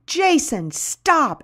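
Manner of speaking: high, contained narrated yell; mock scream. A narrator's reading. Dolly chases her ex-husband toward the woods, mock screaming. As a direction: cry out high, a scream held back for reading. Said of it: "Dolly is screaming".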